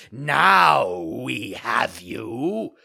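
loud, very slow menacing villain voice; threatening growl. Acted. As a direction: savour the catch, menacing. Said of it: "two horrible voices growled threateningly".

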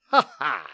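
a short mocking pirate laugh; sneering laugh. A narrator's reading. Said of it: "he sneered".

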